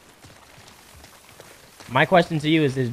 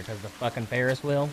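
ordinary talk, nothing marked

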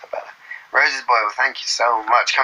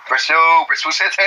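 loud, broad Bristolian; Bristol accent; 2 semitones higher, 3 dB louder than usual. Pretend. Cheering the home town, broad Bristol accent. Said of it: "I'm from Bristol".

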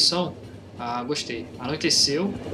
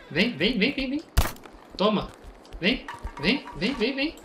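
higher and higher come-on, climbing; taunting call; 7 semitones higher, slower than usual. Real, not acted. Player luring creatures toward his chair, rising taunt. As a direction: lure the monster in, getting more excited.

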